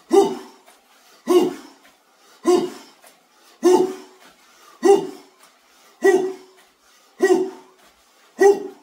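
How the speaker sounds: high, loud breathy whew exhales; panting whews. Real, not acted. Lifter blowing out breath after a heavy set, panting. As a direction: blow out hard after the set.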